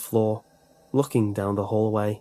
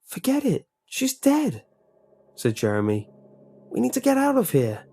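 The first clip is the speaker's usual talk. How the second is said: pitched way up, urgent; urgent and high; 12 semitones higher than usual. A narrator's reading. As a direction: snap the orders fast and high. Jeremy urgently overrules Nick.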